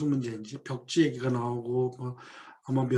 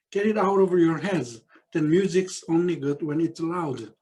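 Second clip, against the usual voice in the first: 1 slow read quote; much slower than usual. Acted. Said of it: "사이먼 스팀슨이 하는 말입니다".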